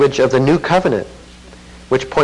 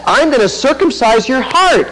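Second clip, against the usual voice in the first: God's promise, preached higher and a little louder; a lightly voiced God making a firm promise; voicing God's promise; 11 semitones higher, 4 dB louder than usual. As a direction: proclaim it as God's own pledge. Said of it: "It's exactly what he says".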